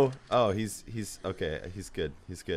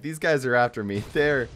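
ordinary talk

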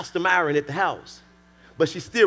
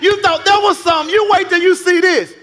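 high, loud, indignant preacher acting out a quarrel; full-force preaching; 10 semitones higher, 7 dB louder than usual. Husband firing back at his critical wife, full-force preaching. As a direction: shout it as a husband who feels unappreciated.

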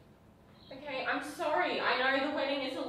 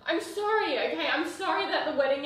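tearful pleading; 6 semitones higher, 4 dB louder than usual. Real, not acted. Fiancée begging her partner not to leave, tearful and high. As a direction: beg through real distress.

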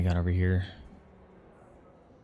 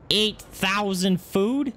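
high, slow rising disbelief; much higher, slow drawn-out question; incredulous; 11 semitones higher, 3 dB louder, much slower than usual. Real, not acted. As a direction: stretch it out in shock. Shocked at the cost.

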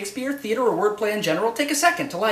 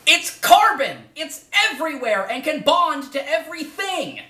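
excited exclaim; 7 semitones higher than usual. Real, not acted. Teacher hyping the iamb to class, excited exclaim. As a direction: announce it like a big discovery.

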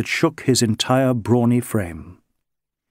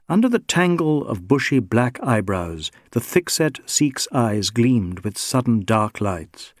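ordinary delivery

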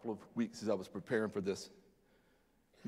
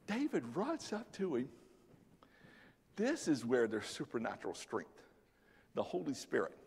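hushed; 2 semitones higher, 8 dB quieter than usual. Acted. Hushed build after the fall.

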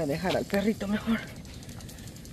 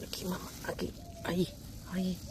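whisper; 10 dB quieter, faster than usual. Real, not acted. Whispering, placing baby by mother.

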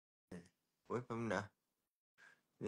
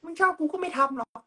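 much higher, thin cartoon squeak; squeaky high voice. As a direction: pitch right up into a squeak. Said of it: "ชอบเดี๋ยวอัดเสียงนี้ไป".

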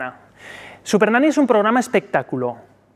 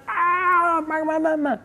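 put-on whiny toddler; 10 semitones higher, much slower than usual. Child fussing and crying.

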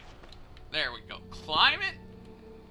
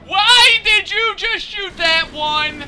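loud, high, drawn-out wail; exasperated yell; 12 semitones higher, 11 dB louder than usual. Real, not acted. Player berates Lara for shooting that one, exasperated. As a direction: yell it in real frustration, stretching the words.

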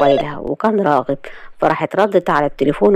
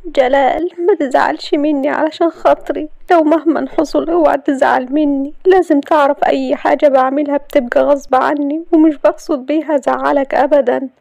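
weakly; 8 semitones higher than usual. A narrator's reading. Layla weakly pleading with Galal not to be upset. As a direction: beg him weakly not to be angry. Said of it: "وراحت قالت له بضعف".

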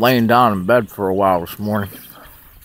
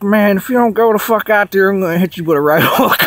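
higher, mock Tyson threatening a right hook; a playful threat in a pitched-up voice; high-pitched Mike Tyson threat; 9 semitones higher than usual. Tyson threatening the dreamer if he won't go, high-pitched mock voice. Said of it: "With that high-pitched voice".

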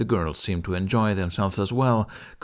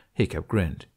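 ordinary delivery, nothing marked

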